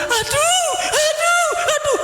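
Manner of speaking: very high mock wail; comic falsetto howl of agony; cry of pain. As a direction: yelp in pain, hammed up. Imitates his own cries of pain.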